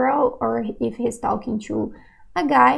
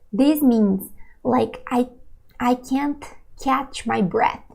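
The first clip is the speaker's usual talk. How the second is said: normal talking voice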